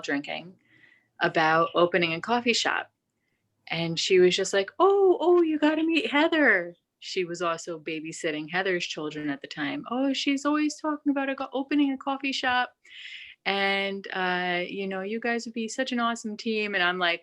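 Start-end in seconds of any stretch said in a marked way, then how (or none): 4.8-6.8 excited, high-pitched Irish lilt; high mock-Irish exclamation; Irish accent. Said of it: "that accent that she's doing, it's Irish". 9.9-16.7 high-pitched Irish babysitter impression; put-on Irish lilt, high voice; [name]'s Irish accent. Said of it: "that accent that she's doing, it's Irish".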